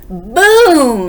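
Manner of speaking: big, high, drawn-out boom; loud crash word. A narrator's reading. Story crash acted out loudly.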